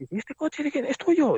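high-pitched aggressive stranger; mock interrogation. Retold aggressive questioning by stranger. (interrogatorio imitado, con voz muy aguda)

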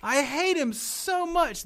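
high-pitched, drawn-out exasperation; exasperated outburst. Real, not acted. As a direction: let the loathing burst out high and slow. Exasperated outburst about hated wrestler.

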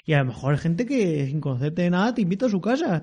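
ordinary delivery

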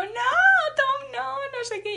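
high, loud pretend wail; hammed-up dream sobbing; mock crying. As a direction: plead like a child in a dream, half laughing. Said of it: "llorando y llorando".

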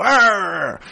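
gruff pirate growl, loud; grumpy old sailor. Retold or imitated. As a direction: growl it like a cranky sea dog. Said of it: "es como un viejo cascarrabias, como Don Cangrejo".

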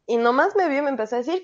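ordinary delivery

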